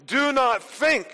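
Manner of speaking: very strong denial; emphatic. Acted. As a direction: press hard on each word. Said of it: "notice how emphatically he puts it here, very strongly".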